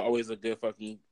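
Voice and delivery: ordinary talk, nothing marked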